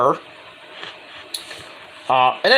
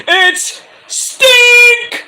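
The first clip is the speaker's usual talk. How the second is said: much higher, loud announcer call; Tony Baloney announcing; 7 dB louder than usual. Retold or imitated. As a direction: hype it like a ring announcer. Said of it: "we have to hear Tony Baloney".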